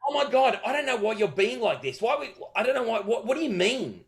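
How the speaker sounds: high, whiny put-upon voice; mock offended girl. Cheeky girl at the pub acting like he's the problem. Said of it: "she acted like it was like I was the problem".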